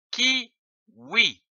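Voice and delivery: kiwi stretched out slowly; slow teaching read of kiwi; slow clear word. Shown as a demonstration. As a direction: draw out kiwi for learners.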